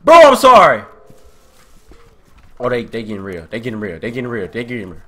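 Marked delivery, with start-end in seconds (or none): 0.0-0.8 high, loud plea; raised-pitch cry for mercy; panicked apology. Real, not acted. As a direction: yell the apology desperately. Pleading after being hit.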